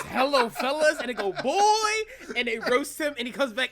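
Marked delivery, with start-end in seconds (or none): 0.0-1.0 loud, high cartoon greeting; retelling a cartoon kid's cheesy greeting; mock hello fellas. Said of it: "And, like, this". 1.3-1.9 put-on high shouted boy; mock roast shout. Roast in retold scene.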